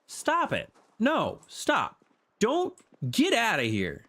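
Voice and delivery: high squealing stop it; high, playful get outta here; playful protest. Real, not acted.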